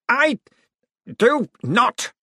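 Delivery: high, loud, broken into separate words; high-pitched indignant outburst; furious refusal. A narrator's reading. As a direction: refuse with outraged pauses. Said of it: "a furious but wavering finger".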